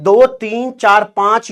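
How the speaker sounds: normal talking voice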